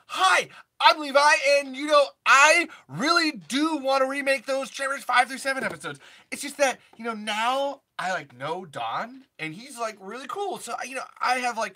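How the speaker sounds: high, loud, hammed-up version of oneself; exaggerated self-impression. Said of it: "I did an impression of myself".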